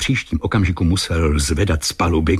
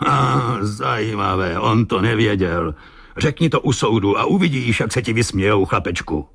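higher-pitched sarcastic captain; mocking; 5 semitones higher than usual. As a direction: ridicule the boy's excuse. Captain mocks Joe's excuse. (posměšně, vyšším hlasem)

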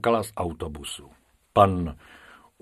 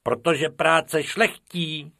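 Bob's voice; 6 semitones higher, slower than usual. Acted. Bob lecturing Bobek.

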